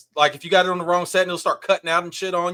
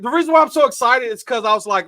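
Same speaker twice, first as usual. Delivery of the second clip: ordinary talk, nothing marked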